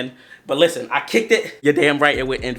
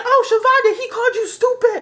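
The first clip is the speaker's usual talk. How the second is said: high tattling voice, loud on the oh; snitching kid; 3 dB louder than usual. Retold or imitated. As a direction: tell on him like a gleeful snitch. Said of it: "He said".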